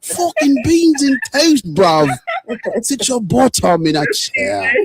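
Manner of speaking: a hammed-up London accent offering a drink; mock British. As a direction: ham up a British geezer teasing a friend. Mocking British after beans and toast.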